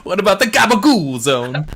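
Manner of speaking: loud, high mock Italian; comic Italian outburst; Italian accent. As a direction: exclaim it in a hammed-up Italian accent. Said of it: "explain it in the Italian accent".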